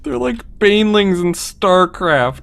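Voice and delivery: loud, slow, seething; angry rant. Real, not acted. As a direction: drag the words out in fury. Ranting after dying.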